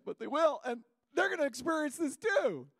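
high, loud, swelling climax; excited proclamation. Real, not acted. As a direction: preach it as a thrilled promise.

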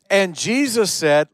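normal talking voice

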